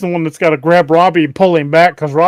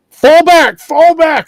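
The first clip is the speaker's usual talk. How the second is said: yelled warning over the radio, higher and louder; urgent shout; 12 semitones higher, 8 dB louder, much slower than usual. Retold or imitated. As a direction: shout the retreat order. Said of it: "Doc goes".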